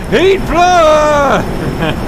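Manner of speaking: high, drawn-out yell; hammed-up attack call, pitched high; excited battle cry. Shouting during the chase.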